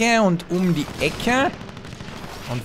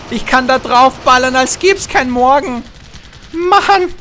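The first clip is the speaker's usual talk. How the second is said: excited; 10 semitones higher, 7 dB louder than usual. Real, not acted. Gamer hyped while attacking.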